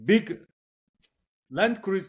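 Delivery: ordinary talk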